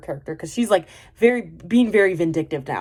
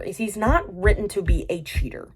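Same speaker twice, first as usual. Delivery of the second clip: ordinary delivery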